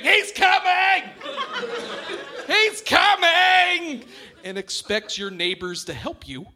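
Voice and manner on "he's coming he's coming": slow, high mock-panicked yell; panicked screaming. Comic acting out fleeing the clown truck, high mock panic. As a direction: wail it like fleeing a killer clown. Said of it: "run away screaming".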